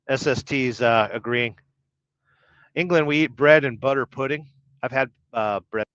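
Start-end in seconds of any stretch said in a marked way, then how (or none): none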